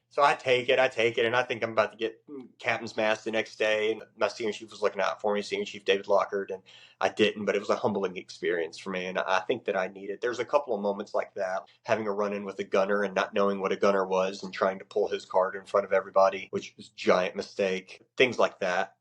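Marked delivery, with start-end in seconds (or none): none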